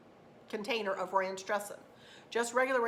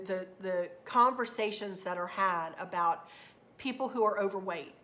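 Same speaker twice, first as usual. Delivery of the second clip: ordinary talk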